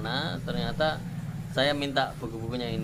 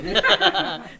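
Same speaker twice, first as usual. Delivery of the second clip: sudden loud laugh; loud burst of laughter; 8 semitones higher, 13 dB louder than usual. Real, not acted. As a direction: laugh out loud at your own story. Laughs about being broke then.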